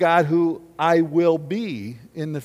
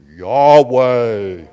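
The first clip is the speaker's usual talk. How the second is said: loud, drawn-out God voice; 4 semitones lower, 10 dB louder than usual. Shown as a demonstration. Pastor shows congregation how to say God's name, in a loud God voice. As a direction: boom it out like God. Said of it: "in your God voice".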